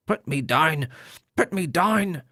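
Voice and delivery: high nasal voice, shouting angrily; angry nasal shout. A narrator's reading. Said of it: "shouted Ern angrily, in a high-pitched nasal voice".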